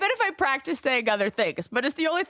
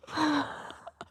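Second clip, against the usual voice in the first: muffled gasp; 5 dB quieter than usual. Shown as a demonstration. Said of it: "ventriloquism skills".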